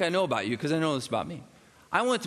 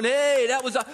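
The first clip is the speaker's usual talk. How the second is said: sudden loud high cheer, cut off; mock cheer; 10 semitones higher, 4 dB louder than usual. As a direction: shout it like a surprise fan. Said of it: "nobody's jumping out going".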